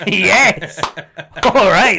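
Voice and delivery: loud, high celebratory yell; gleeful cheer. Retold or imitated. Friend afterwards loving the rant, gleeful shout. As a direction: whoop it like a delighted mate. Friend's delight, thoroughly loved it.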